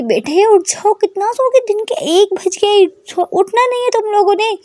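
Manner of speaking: high, loud scolding call through a door; mother banging on the door, yelling to wake them. A narrator's reading. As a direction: shout through the door at sleeping girls. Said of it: "वो ज़ोर से पीटती है".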